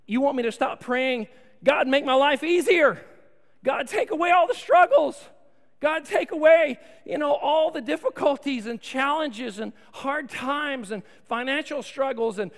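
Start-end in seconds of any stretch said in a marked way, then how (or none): 0.1-7.8 loud, high, hammed-up pleading; mock pleading prayer. Pastor mimicking the church's prayers, loud and high. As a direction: shout the prayers like a desperate parody.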